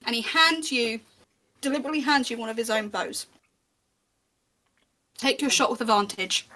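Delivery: usual speaking voice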